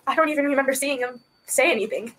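ordinary delivery

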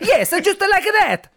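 high, laughing mock Italian; hammed-up Italian accent. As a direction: play the approving Italian, hammed up. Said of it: "the way Italians sound".

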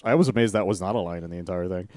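ordinary talk, nothing marked

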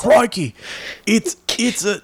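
mock Australian accent. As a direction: exclaim like a pretend Australian, then falter. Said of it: "now you just say".

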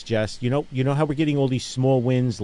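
ordinary delivery

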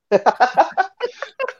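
high, drawn-out laugh; long high-pitched laughter; real laugh. Real, not acted. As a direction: laugh it out high at your own joke. Laughing at own hushing joke.

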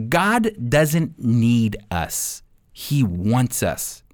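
slow, deliberate, each word weighed; slow emphasis. Real, not acted. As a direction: let the line land slowly and gently. Key message of the series.

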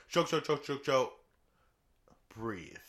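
punchy burst with a breather; bursts, then breathe. Shown as a demonstration. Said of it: "But they're like".

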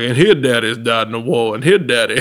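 loud mock movie narration; Lieutenant Dan. Filmmaker imitates the Forrest Gump scene about military fathers, hammed-up voice. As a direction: put on a film character's voice, loudly. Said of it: "like the Lieutenant Dan scene from".

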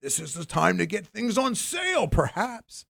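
high, shouted salesman voice; mock broker pitch. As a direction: sell it like a pushy broker. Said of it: "tell that person ... going".

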